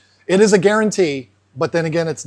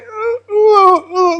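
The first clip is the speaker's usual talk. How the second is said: high, crying fish whimpers; terrified whimper; 13 semitones higher, 5 dB louder, much slower than usual. Retold or imitated. Terrified fish huddled in the corner, whimpering. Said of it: "miserable, like, terrified".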